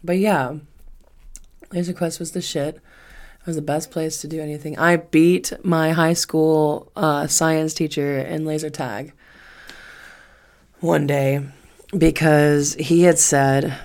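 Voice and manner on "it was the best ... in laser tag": ordinary talk, nothing marked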